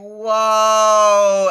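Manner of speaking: loud, high, long whoa; big drawn-out whoa. Retold or imitated. Reliving the reaction in the bathroom, drawn-out whoa. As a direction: stretch out a booming, amazed whoa. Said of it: "I'm laughing like".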